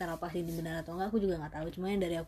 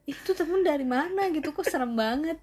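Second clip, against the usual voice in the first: lightly voiced mother, higher and louder; imitating alarmed mother; 9 semitones higher, 7 dB louder than usual. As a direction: retell a mother's startled reaction, pitched up. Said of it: "ibuku ngeliat fotonya aja tuh kayak".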